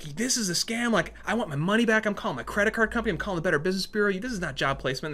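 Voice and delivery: furious customer demanding a refund, mildly replayed; angry complaint. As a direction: fire off threats on the phone. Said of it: "I was so mad".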